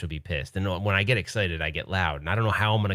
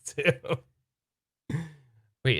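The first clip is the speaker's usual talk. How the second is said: ordinary talk, nothing marked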